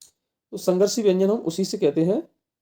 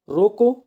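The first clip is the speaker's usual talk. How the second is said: "रोको" set apart, then a clear stop; pause after रोको; 3 semitones higher, 5 dB louder, much slower than usual. Shown as a demonstration. Teacher reading out where the comma falls, pause after "रोको". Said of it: "coma यहाँ लगा हुआ है".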